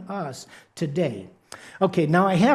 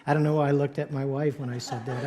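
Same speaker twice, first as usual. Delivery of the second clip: ordinary talk, nothing marked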